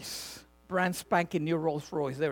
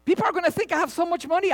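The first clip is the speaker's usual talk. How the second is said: excited retort; 11 semitones higher, 3 dB louder, faster than usual. Retold or imitated. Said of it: "I'm like".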